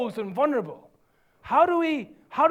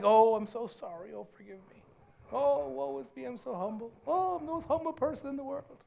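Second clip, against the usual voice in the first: whining false humility; 7 dB quieter than usual. Acted. Preacher mocks false humility to the congregation, whining. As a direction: whimper like a sick cat. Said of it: "whining like a sick cat".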